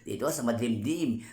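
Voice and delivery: ordinary delivery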